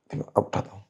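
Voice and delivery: whispered under the breath. Acted. Man sneaking in whispers to himself. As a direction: hush it, sneaking up to the house. Sneaking in, planning the blind act.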